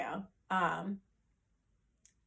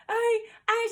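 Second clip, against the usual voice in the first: high, stammered retelling of being too overcome to speak; mock hyperventilating; 12 semitones higher than usual. Telling sister the news through tears, re-enacted choked stammer. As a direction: act out struggling to get words out. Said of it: "hyperventilating, sweating, breaking down, crying".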